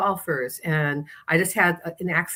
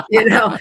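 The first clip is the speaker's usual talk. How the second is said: bright burst of laughter, much higher and louder; real laugh; 5 semitones higher, 9 dB louder than usual. Real, not acted. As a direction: laugh out loud, then squeeze out you know. Laughing at her own story.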